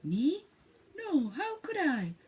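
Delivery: high, innocent bird voice; a prim little dove protesting. Acted. Dove answering the mole, high and innocent. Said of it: "she answered".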